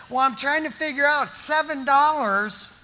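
usual speaking voice

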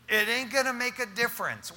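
ordinary delivery

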